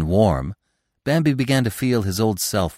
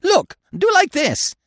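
very high, slow, drawn-out bird voice; gleeful woodpecker; 4 dB louder, slower than usual. A narrator's reading. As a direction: crow it high, stretching the words with delight. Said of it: "said the woodpecker with a laugh".

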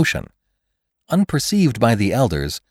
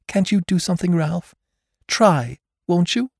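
anguished plea; 10 semitones higher than usual. Acted. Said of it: "her large eyes full of anguish".

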